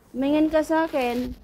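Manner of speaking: playfully stern, pitched well up; insistent mock scolding. Owner orders cat to look over, insistent mock scolding.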